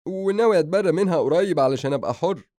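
high, loud, dramatic declaration; indignant younger brother; mock defiance. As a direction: declare your freedom with comic force.

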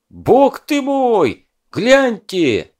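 high, slow wailing shout; wailing cry. A narrator's reading. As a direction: wail it out in alarm. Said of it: "заголосил Ермак".

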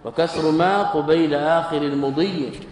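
a lightly chanted line of grammar verse; teacher intoning the verse rule to students; verse recitation. Acted. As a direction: recite the rhyme slowly and evenly.

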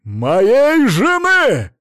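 very loud, high, long-drawn scream; furious drawn-out shout; enraged yell. A narrator's reading. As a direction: bellow it out, beside yourself. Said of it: "заорал выведенный из себя Мамонов".

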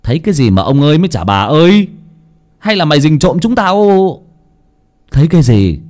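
loud, fast annoyed mistress; irritated lady of the house; angry scolding. Acted. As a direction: bark the questions impatiently.

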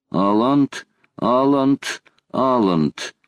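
ordinary delivery, nothing marked

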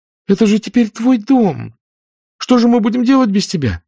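put-on high, pleading gentle young woman; near tears. A narrator's reading. Melanie pleads with Scarlett, high and close to tears. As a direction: beg her friend not to leave.

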